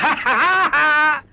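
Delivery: a high, amused laugh at the idea; mocking laugh. Thief laughs at the policewoman's request, amused high laugh. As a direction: laugh at the cop asking him for help.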